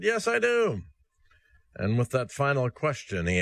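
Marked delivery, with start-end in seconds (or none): 0.0-0.8 lightly voiced woman. Woman answers boy in joke.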